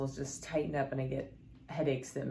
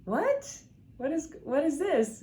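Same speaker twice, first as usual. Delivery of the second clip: very high, louder startled question; surprised; 12 semitones higher, 4 dB louder, slower than usual. Retold or imitated. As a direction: squeak it out in shocked disbelief. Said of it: "I'm like".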